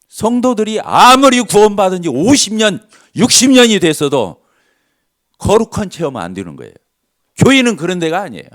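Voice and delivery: emphatic preaching. Real, not acted.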